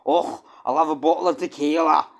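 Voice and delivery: loud, high, exaggerated Scottish accent; Scottish accent blurted out at full volume; full-blown Scottish accent. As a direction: go all out with a hammed-up Scottish voice. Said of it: "go into a full-blown fucking Scottish accent".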